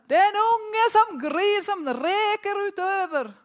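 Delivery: high, loud, drawn-out shout; varselrop. Retold or imitated. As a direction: call out an alarm at full volume across the water. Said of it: "hører jeg bare noen som roper". (høyt, langtrukket varselrop)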